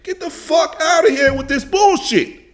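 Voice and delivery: heated, high-pitched outburst of disbelief; high, angry dismissal. Real, not acted. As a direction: snap it out, fed up. Furious rant at the interview clip.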